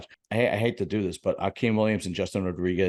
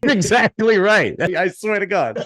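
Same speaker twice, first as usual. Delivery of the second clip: laughing through the words, slow; cracking up at the right guess; 11 semitones higher, 8 dB louder, slower than usual. Real, not acted. Host laughing as his cohost guesses the fish guy's name.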